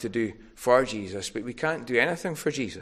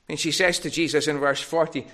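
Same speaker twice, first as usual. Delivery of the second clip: usual speaking voice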